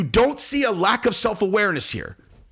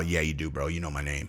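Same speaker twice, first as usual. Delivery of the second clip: much lower, softer, stubborn bro; low mock insistence; 12 semitones lower, 7 dB quieter than usual. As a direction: mutter it like a sulky bro. Mocking Fabio low.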